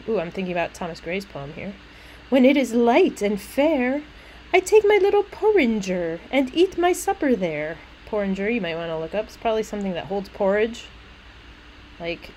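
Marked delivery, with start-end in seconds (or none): 2.3-7.8 higher, lilting verse reading; little maid. Acted. Little maid telling of supper by the graves, lilting. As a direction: recite it as a child's simple rhyme. Reads the maid's lines.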